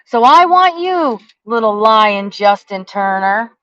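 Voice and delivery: a deliberate, furious call-out; angry slow shout. Real, not acted. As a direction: call him out by name, loud and deliberate. Angry rant naming the enemy.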